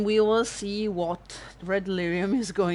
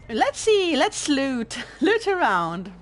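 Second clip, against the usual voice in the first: sudden perk-up; 7 semitones higher, 7 dB louder than usual. Real, not acted. Cheers up, jumps to looting.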